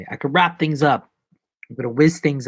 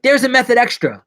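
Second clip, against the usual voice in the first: mock shout; 11 semitones higher, 8 dB louder than usual. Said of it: "Russell Crowe was like".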